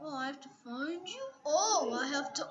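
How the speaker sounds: usual speaking voice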